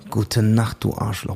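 imitated hero one-liner. Fan copies the voice actor's film line, low imitation.

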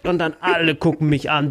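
normal talking voice

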